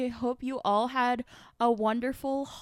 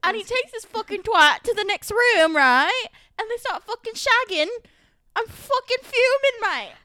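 put-on loud, higher British accent rant; mock British islander; 9 semitones higher, 7 dB louder than usual. Playing a Love Island contestant telling of a betrayal, British accent. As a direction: tell the betrayal like a reality show star. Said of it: "you just killed that".

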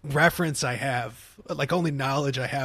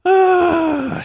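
loud high sigh; 10 dB louder than usual. Real, not acted. Exclaims after laughing fit.

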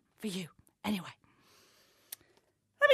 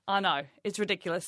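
ordinary talk

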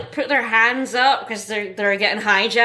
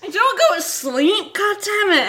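annoyed yell; 10 semitones higher than usual. Retold or imitated. Hijacker scolding sleeping Spike.

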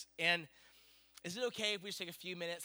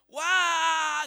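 high, loud drawn-out exclamation; child's mock amazement; 11 semitones higher, 12 dB louder than usual. Daughter answers parent's royal excitement, exaggerated amazement. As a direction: stretch it out high like a kid feigning awe.

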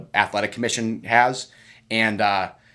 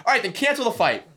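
much higher, sarcastic mock reply; mock defiance; 7 semitones higher than usual. Fighter mocking the officials about being late, high sarcastic. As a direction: pretend to cancel the fight out of spite.

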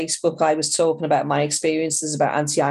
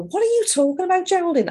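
much higher, fast mock disbelief; mock incredulous; 13 semitones higher, faster than usual. People scoffing at her Irish claim, mock incredulous. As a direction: toss it off in a squeaky, baffled tone. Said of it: "people kind of look at me and go".

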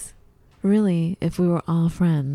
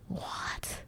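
stunned whisper; 16 dB quieter than usual. Retold or imitated. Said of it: "'cause I'm like".

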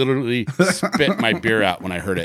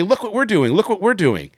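mocking; 9 semitones higher than usual. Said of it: "to say".

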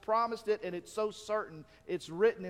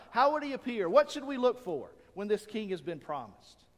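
usual speaking voice

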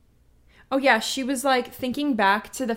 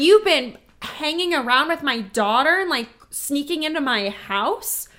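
outraged mother; 4 semitones higher, 5 dB louder than usual. Retold or imitated. Angry mother quoted.

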